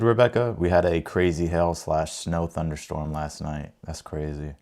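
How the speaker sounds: ordinary talk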